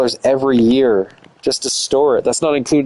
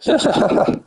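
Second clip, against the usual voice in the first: laughing; 10 semitones higher than usual. Real, not acted. Laughs at his own plan.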